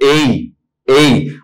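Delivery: ए held still on one pure sound; steady pure vowel. Shown as a demonstration. Teacher showing students a vowel that stays put, steady pure vowel. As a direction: say ए without any glide. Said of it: "एक जगह रुकी नहीं है".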